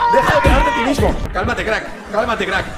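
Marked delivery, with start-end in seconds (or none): 0.1-1.2 high, loud yell of the same line; exasperated scream; shouted. Acted. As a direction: bark it out way higher and louder. Intro clip, repeated louder.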